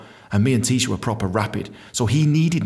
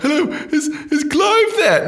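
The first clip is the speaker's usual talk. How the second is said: high, hesitant mock-nervous voice; timid caller; 4 dB louder, slower than usual. Sacked dentist rings his boss at home, high and timid. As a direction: squeak it out, stalling and drawn out.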